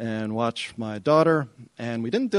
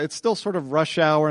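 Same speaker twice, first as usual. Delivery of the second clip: normal talking voice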